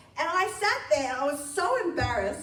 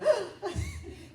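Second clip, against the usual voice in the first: mock gasp; 9 semitones higher than usual. Playful gasp at punchline.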